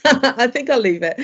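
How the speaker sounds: laughing it off; amused, backing out with a laugh. Real, not acted. Backs out of the Cockney joke.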